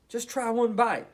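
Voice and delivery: high, gentle coaxing. Acted. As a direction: coax her into one bite. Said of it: "Toot coaxed".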